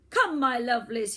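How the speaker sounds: very loud summoning call; shouted. Acted. Boy villain shouts to summon his creatures, very loud. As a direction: call your minions. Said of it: "he shouted".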